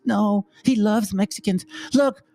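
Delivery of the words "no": ordinary talk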